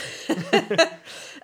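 high burst of laughter; laughing. Real, not acted. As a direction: let a big laugh out. Laugh after joke.

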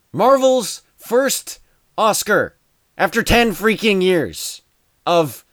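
high, slow, loud excitement; excited. Real, not acted. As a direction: celebrate the win with gusto. Excited rant about Marvel win.

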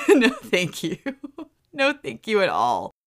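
laughing, high no thank you; real laughter as she refuses; laughing refusal. Real, not acted. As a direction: giggle through the refusal.

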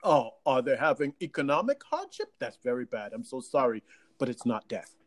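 low, measured mock sympathy; Cuomo. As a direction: concede it solemnly, then dismiss it. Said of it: "he was like".

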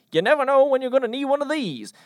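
high, fast, very loud shout; loud acted shout. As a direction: holler it in one quick high rush. Acted dynamite line amid frantic escape.

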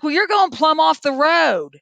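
high, loud and slow warning; alarmed warning. Retold or imitated. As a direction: call out the drifting car. Warning a distracted driver.

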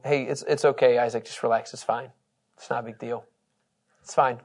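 soft, hushed calming of a frightened son; reassuring father. Acted. Abraham calming Isaac on altar.

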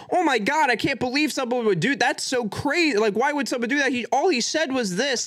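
high-pitched mock disbelief; hammed-up scandalised outrage; shocked onlooker. Voicing shocked social media users.

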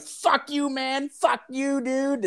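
high mock yell of a clueless kid; imitating Ron's yell. Ron yells at the old man at the door, high mock yell. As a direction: yelp it up high, dumb and defiant.

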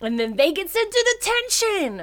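high, loud storytelling climax; loud, incredulous punchline; outraged exclamation. Real, not acted. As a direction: pitch it way up in mock outrage.

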